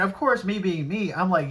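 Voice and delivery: ordinary talk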